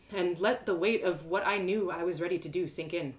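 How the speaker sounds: ordinary delivery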